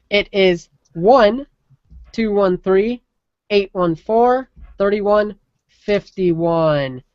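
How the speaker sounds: slow, spelled-out digits; slow repeat. Shown as a demonstration. Repeating the call-in number for listeners, slow and clear. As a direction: read the number slowly and clearly. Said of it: "do it again".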